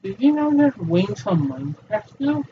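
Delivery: ordinary talk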